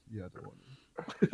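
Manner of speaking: tiny, low imitated mumble; mock meek refusal. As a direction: mimic a sheepish refusal under your breath. Friend mimics refusal in bed.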